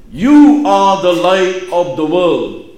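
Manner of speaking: "You" hit high and loud, then each word slow and weighty; stress on YOU. Acted. Preacher quoting Jesus to the congregation, emphatic stress on "You". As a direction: punch "You" and spell out the rest slowly. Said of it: "the emphasis is on you".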